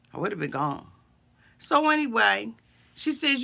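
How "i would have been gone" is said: low, much softer echo of I would have been gone; under-the-breath repeat; quiet repeat. Real, not acted. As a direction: murmur it again to yourself. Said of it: "the only difference between me and Jenny".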